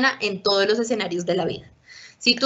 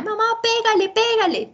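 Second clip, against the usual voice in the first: put-on much higher, egging-on kid voice; a little brother urging mom to hit; meddling sibling; 12 semitones higher than usual. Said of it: "hermanos que están metiendo ahí cizaña".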